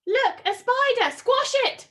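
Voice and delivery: high, loud frightened shriek; scream. A narrator's reading. A child screaming at the spider in class, high and loud. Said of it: "screamed someone".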